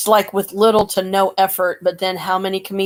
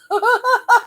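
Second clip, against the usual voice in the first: high laugh. Real, not acted. Laughing at gigawatt.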